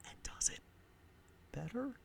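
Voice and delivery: secretive whisper, trailing off into a question. Acted. Conspiratorial aside about the remake.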